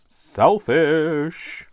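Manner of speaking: high, loud, drawn-out jibe; playful loud name-calling; mock accusing. Mock verdict on the friend's message.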